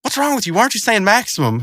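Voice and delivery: high-pitched imitated indignation; indignant cartoon character. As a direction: squawk it in mock disbelief. Acting out Rigby's indignant challenge to Mordecai.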